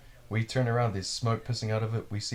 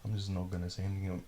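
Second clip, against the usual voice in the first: quick, soft hushed thought; 3 semitones lower, 7 dB quieter, faster than usual. Retold or imitated. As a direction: keep it low so he can't hear. A scary man is outside the door.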